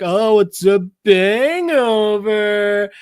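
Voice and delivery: put-on high, slow drunken crowing; drunk guy. Drunk friend cracking a pun to his buddy, loud slurred drawl. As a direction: draw it out like a sloppy drunk. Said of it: "dude's still fucking drunk".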